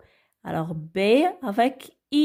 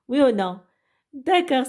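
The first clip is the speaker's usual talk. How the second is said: ordinary delivery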